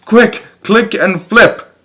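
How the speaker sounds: high, loud, drawn-out warning; urgent cry. A narrator's reading. Wise old beetle urgently warns the young one, high and loud. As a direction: shout a warning in a hurry. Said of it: "cried the wise old click beetle".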